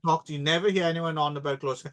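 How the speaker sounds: usual speaking voice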